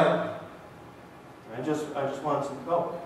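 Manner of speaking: low, soft, dopey mumble as Darwin; imitating Darwin. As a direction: lightly mimic a brother who just wants Coke. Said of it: "And he'll just like".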